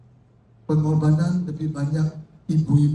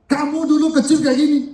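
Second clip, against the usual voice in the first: emphatic father; 9 semitones higher, 4 dB louder, faster than usual. Retold or imitated. Father tells son.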